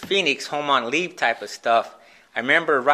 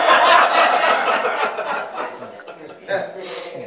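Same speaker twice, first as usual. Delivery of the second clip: real laugh; 5 semitones higher than usual. Real, not acted. Laughing at his own story.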